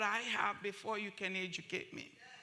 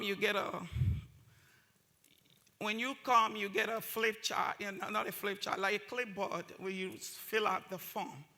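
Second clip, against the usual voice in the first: ordinary talk, nothing marked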